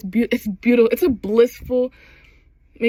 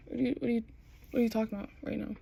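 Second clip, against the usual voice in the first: soft, faltering disbelief; stunned and hurt; 12 dB quieter, much faster than usual. Acted. Lover stunned that his partner is leaving, quiet disbelief. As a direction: falter softly, then rush the question.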